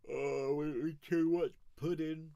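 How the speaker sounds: hammed-up high whine; mock groaning complaint. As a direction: ham up a pudding overdose. Mock moaning about pudding.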